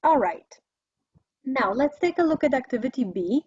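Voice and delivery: ordinary talk, nothing marked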